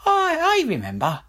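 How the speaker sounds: high stammering royal voice; a king covering his guilt; hurried king. Acted. King pretending to remember the seamstress, high and flustered. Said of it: "said King Fred hurriedly".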